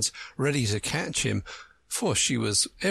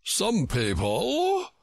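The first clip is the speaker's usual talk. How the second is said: disdainful; 9 semitones higher, much slower than usual. A narrator's reading. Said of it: "said Humpty Dumpty".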